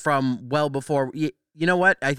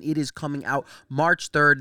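usual speaking voice

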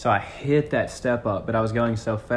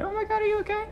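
put-on much higher, alarmed young woman; worried girl voice; faster than usual. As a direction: squeak it out like a startled driver. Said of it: "she was like".

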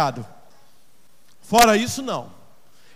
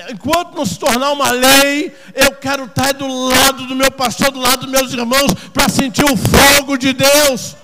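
loud, driving sermon delivery; raised-voice exhortation from the pulpit; fervent preaching; 4 semitones higher, 9 dB louder than usual. Acted. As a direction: preach it hard, stretching the key phrases.

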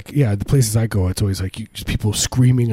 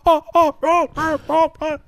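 moaning patient imitation. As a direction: moan like someone in the dentist chair. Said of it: "the moans would make the".

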